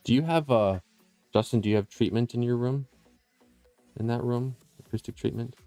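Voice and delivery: ordinary delivery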